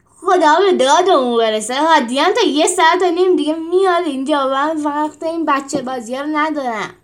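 loud, exasperated shouting; angry outburst. Acted. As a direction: vent your fury loudly. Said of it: "با عصبانیت تبار زد".

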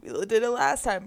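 normal talking voice

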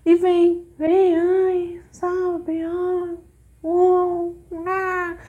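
high, drawn-out mock-meek voice; imitação de falsa mansa. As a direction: play the fake sweetie, cooing in a high held pitch. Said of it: "aí ela vem mansa". (voz mansinha e fingida, bem aguda)

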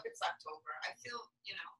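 breathy whisper trailing off; fading whispered aside. Real, not acted. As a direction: drop to a whisper.

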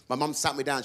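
ordinary talk

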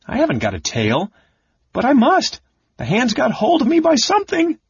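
frantic little kender, pitch shooting up; wild panic. A narrator's reading. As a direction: reason it out in squeaky alarm. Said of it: "Tass thought wildly".

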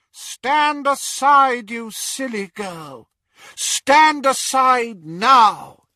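loud, high, drawn-out order; menacing command. Acted. Intruder ordering a mother away from her child, menacing. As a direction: shout it slowly as a threat.

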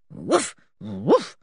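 lightly voiced questioning dog bark; a hint of a puzzled dog's woof. Dog asking Anne where George is, lightly voiced woof. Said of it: "as if he were saying, Please where is George".

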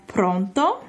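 "Pronto?" with a rising phone-answer tune; Italian pronto. Shown as a demonstration. Teacher showing how Italians answer the phone. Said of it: "in response to their".

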